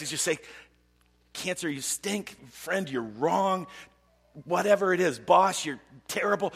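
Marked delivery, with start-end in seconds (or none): 1.3-6.5 higher, emphatic acted denunciation; slow at first, then louder accusations; venting. Believer telling off cancer, friend and boss, venting.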